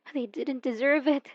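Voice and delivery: ordinary talk, nothing marked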